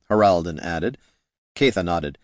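ordinary delivery

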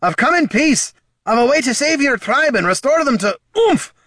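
high, loud plea ending in a winded grunt; pleading shout cut short. A narrator's reading. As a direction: shout urgently until an elbow knocks the wind out. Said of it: "he shouted".

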